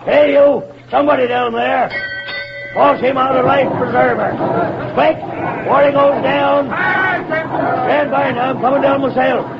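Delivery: high, urgent calls across the water; captain taking charge of a rescue; shouted orders. Acted.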